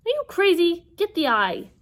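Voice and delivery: high, loud disbelief; exasperated yell. Acted. Annabeth to Percy in the taxi, exasperated yelling. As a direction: yell back in urgent disbelief. Urgent argument in the careering taxi.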